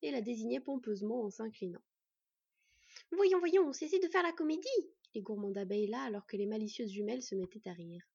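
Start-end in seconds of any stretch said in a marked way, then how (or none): 3.1-4.7 louder, higher motherly reproach; playful scolding. A narrator's reading. Beekeeper scolding mischievous twins, exasperated. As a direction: chide giggling children, raised voice. Woman scolds children fooling around.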